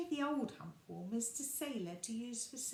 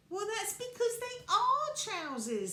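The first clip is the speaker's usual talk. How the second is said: cross, higher and louder; exasperated correction, raised; crossly; 12 semitones higher, 10 dB louder than usual. A narrator's reading. Little Bear corrects Sailor, crossly. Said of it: "said Little Bear crossly".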